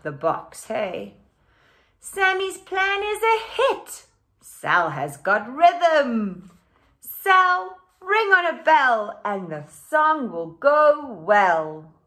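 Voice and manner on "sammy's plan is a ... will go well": bright, louder storybook reading; animated picture book read-aloud; reading to children. A narrator's reading. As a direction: read it out lively for the class.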